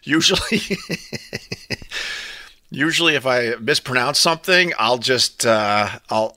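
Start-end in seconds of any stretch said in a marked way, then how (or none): 0.7-2.3 breathy, soft run of laughter; laughing. Real, not acted. Streamer amused by his sun glare excuse, laughing. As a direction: laugh quietly and helplessly at your own joke.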